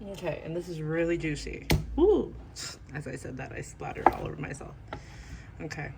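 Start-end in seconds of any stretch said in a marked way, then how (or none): 2.0-2.3 high, loud startled woo; surprised whoop. Real, not acted. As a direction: yelp at the splash. Juice splatters on her.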